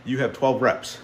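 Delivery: ordinary delivery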